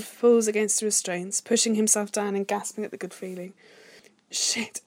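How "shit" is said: whispered curse breaking into a sigh; voiceless word, then a high sighed moan; breathy moan. A narrator's reading. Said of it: "he moaned".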